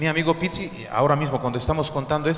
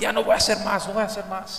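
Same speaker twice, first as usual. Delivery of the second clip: mock pleading; 5 semitones higher than usual. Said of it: "mi amigo hacía esto".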